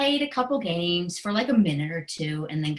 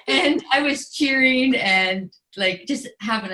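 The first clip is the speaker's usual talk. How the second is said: high bursting laugh into the words; 5 semitones higher, 6 dB louder than usual. Real, not acted. Mother laughing as she recalls cheering for her son. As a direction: laugh through it, delighted.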